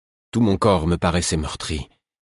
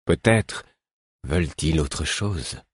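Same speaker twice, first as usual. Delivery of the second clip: slow voice; slower than usual. A narrator's reading. Weakened fool continuing his theory, slow voice. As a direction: drag the question out thoughtfully. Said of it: "fit-il d'une voix lente".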